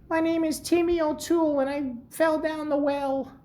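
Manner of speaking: put-on squeaky kid from the Simpsons; Timmy O'Toole. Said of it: "Classic Simpsons".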